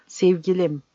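tender coo unlike his own voice; sweet low coo. A narrator's reading. Captain murmuring to the woman, tender cooing. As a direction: coo it like a dove. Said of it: "kendi sesinden çok uzak, tatlı ve alçak".

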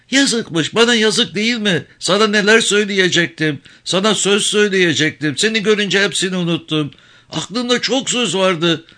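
high, aggrieved lament of a village woman; hurt old mother. Acted. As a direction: complain she forgot everything.

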